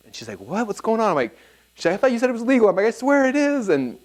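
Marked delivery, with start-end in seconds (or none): 0.4-1.1 high-pitched alarmed wife, imitated; acted-out startled questioning; freaking out. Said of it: "she's freaking out". 2.0-2.7 high-pitched imitation of an upset wife; acted-out spousal alarm, pitched way up; accusing wife. Wife accuses him in acted quarrel. 2.9-3.7 very high-pitched protest; acted-out squirming defense; defensive insisting. Defends himself in acted quarrel.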